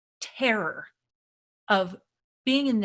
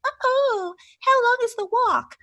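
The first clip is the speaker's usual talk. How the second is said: put-on much higher, louder whiny girl voice; ditzy girl voice; 7 dB louder than usual. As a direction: whine like a pampered hiker. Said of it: "And then they're like".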